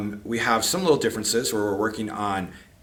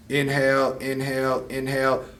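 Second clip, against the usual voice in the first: drawn-out inhale cues. Shown as a demonstration. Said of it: "inhale for about four seconds".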